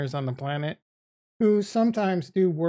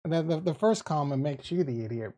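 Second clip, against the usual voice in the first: usual speaking voice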